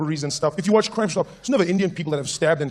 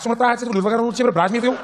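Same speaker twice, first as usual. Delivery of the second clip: put-on high, garbled Cape Afrikaans accent; incomprehensible Cape slang; 7 semitones higher, 3 dB louder than usual. Cape guy repeats himself to baffled visitor, thick accent. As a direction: pour out Cape slang in a raised voice. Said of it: "I don't understand one word you're saying".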